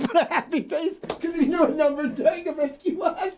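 high-pitched squeal through laughter; laughing hard. Real, not acted. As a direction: squeak the punchline out while cracking up. Comic cracking up at punchline.

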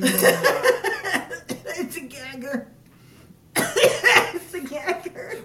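slow, laughing through a gag. Real, not acted. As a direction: drag it out while cracking up. Gagging on applesauce, laughing.

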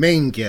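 "Minga" with the ya at the end, falling; correct model. Shown as a demonstration. Modelling the word for Sarah again, ya glide at the end. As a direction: show the ya in "Minga". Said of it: "You're not saying the ya at the end".

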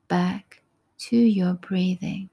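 ordinary talk, nothing marked

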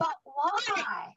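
puzzled, genuinely asking; bewildered why. Retold or imitated. Bewildered at being given money.

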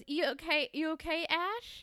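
slightly sugary, high-pitched checking-in; mock concern. Imitates Sally checking on Ashley.

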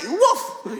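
high imitated woof; sudden high mock bark; dog's bark. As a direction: bark back like the dog. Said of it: "And the dog goes".

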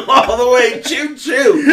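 laughing. Real, not acted. Joking, laughing loudly.

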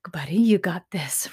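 hushed whisper to a little brother; six-year-old whispering to calm his little brother. Retold or imitated. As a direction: breathe it gently to calm him down. Big brother calming little brother.

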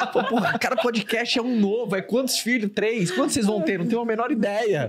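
high amused banter; laughing. Real, not acted. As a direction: talk through laughter. Laughing about many children.

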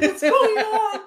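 baffled outburst; laughing bewilderment; bewildered. Retold or imitated. Waking confused in dream.

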